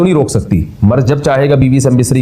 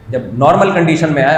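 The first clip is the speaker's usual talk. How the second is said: normal talking voice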